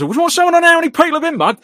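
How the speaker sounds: high, loud East End Cockney bark; Derek Day East End threat. Retold or imitated. Imitating Derek Day threatening the journalists, loud high East End Cockney. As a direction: shout it like an East End hard man squaring up. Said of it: "proper East End stuff".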